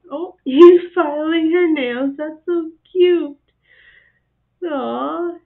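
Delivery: loud delighted cooing; laughing aww. Real, not acted. Monkey filing nails video.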